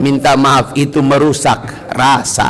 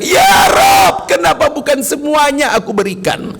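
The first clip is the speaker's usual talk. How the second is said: cry out; 11 semitones higher than usual. Retold or imitated. Pious man crying out to God over his gift. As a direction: call out to God in regret. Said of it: "Dia teriak".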